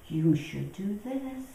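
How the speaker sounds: slow, soft admonishing; conscience voice. Acted. Conscience telling her what to do, softly admonishing. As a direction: play a gently nagging conscience. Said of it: "My conscience that tells me".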